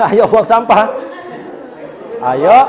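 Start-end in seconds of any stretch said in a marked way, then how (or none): 0.1-0.9 louder, cheerful invitation; rally friends to pick up trash; inviting. Shown as a demonstration. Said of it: "Kira-kira kalau mengajak".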